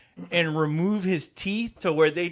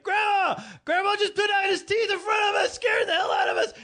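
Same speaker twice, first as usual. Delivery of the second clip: put-on much higher whiny kid voice; kid tattling; 13 semitones higher than usual. Kids telling grandma about the teeth, high and whiny. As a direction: whine it like a scared kid to grandma.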